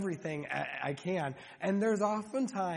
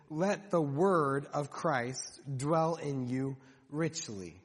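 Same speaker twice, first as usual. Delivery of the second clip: slow emphatic reading; 4 semitones lower, slower than usual. Shown as a demonstration. Preacher slowly reading the verse to the congregation. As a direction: read the verse slowly, weighing each word. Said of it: "Think about that just for a moment".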